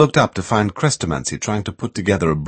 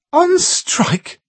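incredulous. A narrator's reading. Carol repeats in disbelief.

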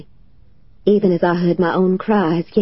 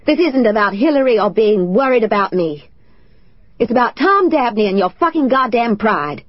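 angry, higher and louder husband's voice; bellowing; 5 semitones higher, 3 dB louder than usual. Acted. Husband bellowing at his wife over unanswered phone calls, loud and angry. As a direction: bellow it at her in fury. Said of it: "he bellowed at me".

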